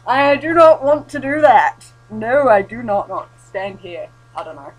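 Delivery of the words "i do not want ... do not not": emphatic refusal. Pretend. Refusing the journal prompt.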